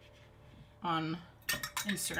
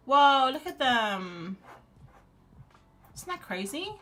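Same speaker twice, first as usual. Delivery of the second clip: surprised; 5 semitones higher, 7 dB louder, slower than usual. Real, not acted. Surprised by puffin photos.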